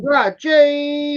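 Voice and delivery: high, loud, slow greeting; hearty shout-out. Real, not acted. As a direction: call out to a viewer in chat. Echoing the greeting.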